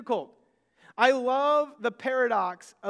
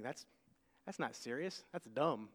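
hushed aside; 11 semitones lower, 15 dB quieter than usual. Retold or imitated. Inner thought muttered.

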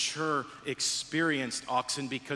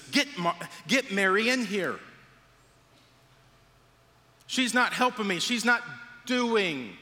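mock yell, higher and louder; Martha yelling; 7 semitones higher, 4 dB louder than usual. Martha yells at Jesus from the kitchen, mock complaint. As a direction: play up an overworked Martha's complaint. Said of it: "she yells at Jesus".

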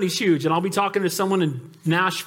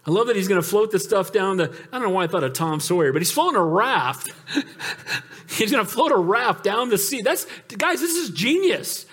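ordinary talk, nothing marked

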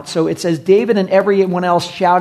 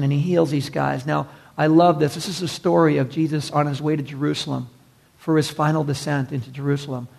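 ordinary talk

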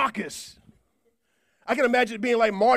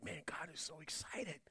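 hushed, breathless whisper; awed whisper; 5 semitones lower, 22 dB quieter than usual. Retold or imitated. Solomon marveling that God will come, in a whisper. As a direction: whisper it in disbelief.

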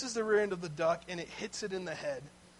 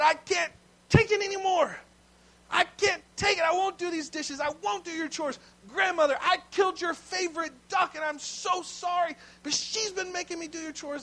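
loud, high, anguished outburst; desperate confession; 9 semitones higher, 4 dB louder than usual. Retold or imitated. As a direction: blurt it all out, at the breaking point. Boy breaks down and confesses.

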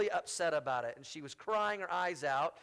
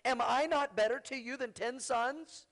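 higher, smug husband voice poked fun at; mocking clueless husband; 7 semitones higher than usual. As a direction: ham up a husband who thinks he's enough. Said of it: "he says something really stupid".

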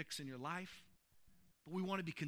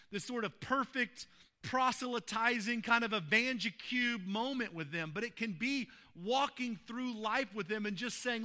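loud, high-pitched preaching swell; preaching at full force; 5 semitones higher, 8 dB louder than usual. Acted. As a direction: drive the point home to the congregation. Preaching builds to loud peak.

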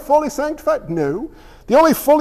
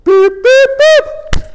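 very high toy-machine beeps; mock machine beeping; high electronic beeps; 7 dB louder, much slower than usual. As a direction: chirp like a game box. Imitating Simon game.

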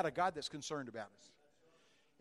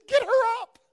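much higher and louder, shouting an order; urgent shout; 19 dB louder, slower than usual. Retold or imitated. Preacher ordering helpers to lift the fallen woman, shouting. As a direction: get them to lift her.

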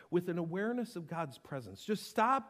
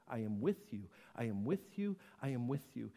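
lower, softer, repeated gently; tender promise; 8 semitones lower, 8 dB quieter than usual. Acted. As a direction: murmur it like a reassuring promise. Reciting God's promise softly.

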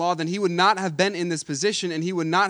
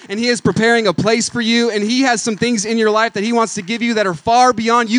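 full-force preaching; 4 semitones higher, 7 dB louder than usual. Acted. Preacher assuring the congregation of God's plan, loud climactic preaching. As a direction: lift the congregation with a rising proclamation.

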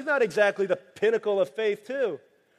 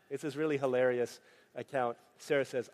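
ordinary delivery

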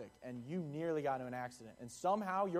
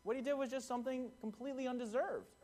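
much higher, mock petulant whine; jealous whine; 9 semitones higher than usual. Richard complains to Kyle, mock jealous whine. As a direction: sulk like a left-out kid. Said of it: "Richard's like".

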